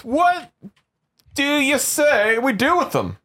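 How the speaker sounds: high, rousing and slow; fired-up mob leader. Pretend. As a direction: whip up the crowd. Rallying mob about prisoners.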